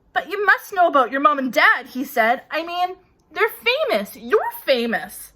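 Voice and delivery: loud, high, disbelieving giant; astonished giant. A narrator's reading. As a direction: press the point, amazed. Hagrid astonished.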